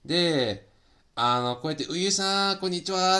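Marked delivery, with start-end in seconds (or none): 1.9-3.2 replaying her own overjoyed hello, pitched up; mock excited hello, higher than her usual talk; retold excited greeting. Streamer re-enacting her muted hello to Uyu, excited. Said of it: "めちゃくちゃはしゃいでた".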